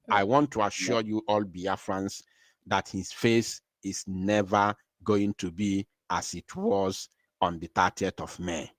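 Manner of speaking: ordinary talk, nothing marked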